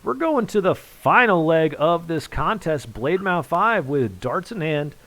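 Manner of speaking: loud, high, carrying announcer delivery; fired-up call easing slightly toward the end; excited commentary. Real, not acted. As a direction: keep the big-moment energy up. Commentator still fired up after checkout.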